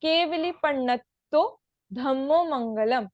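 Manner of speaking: पण्णत्तो with a held ण, recited carefully; measured teaching recitation, पण्णत्तो with doubled ण; careful double ण. Shown as a demonstration. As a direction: press on both ण in पण्णत्तो. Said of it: "ण पे थोड़ा सा जोर देना है".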